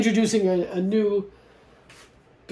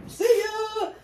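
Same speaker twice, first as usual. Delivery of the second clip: much higher squeaky sing-song goodbye; playful dog voice. Retold or imitated. Imitating her new puppy leaving in the car, squeaky and chirpy. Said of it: "She's like".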